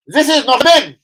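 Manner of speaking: high, loud indignant local man; mock country man. Telling the story of a villager scolding him over litter, high indignant voice.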